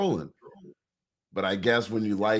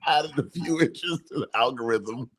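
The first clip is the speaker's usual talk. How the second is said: higher, giggling repeat of a joke; laughing through words; 4 semitones higher than usual. Real, not acted. Friend repeats his own dirty joke, cracking up. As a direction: fight laughter while getting the line out.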